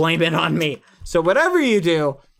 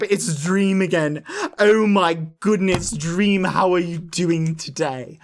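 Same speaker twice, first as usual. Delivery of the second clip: exaggerated, slow British accent; hammed-up British chap, drawing out the words; George. Streamer playing George chatting to Dream over tea, mock British accent. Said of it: "his is more like".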